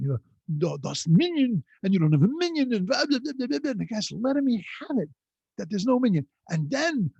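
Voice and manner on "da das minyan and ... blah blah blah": put-on Yiddish accent. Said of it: "with his Yiddish accent".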